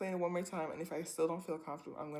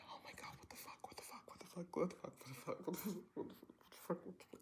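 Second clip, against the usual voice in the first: frantic whispered freak-out, words tumbling over; panicked whisper; 12 dB quieter, much faster than usual. Retold or imitated. Retold panic after he left.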